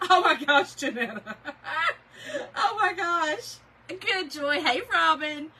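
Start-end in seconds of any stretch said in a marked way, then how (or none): 0.0-3.6 talking through real laughter; helpless giggly exclamation; laughing. Real, not acted. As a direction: crack up while reacting to a friend. Cracks up at a viewer's comment.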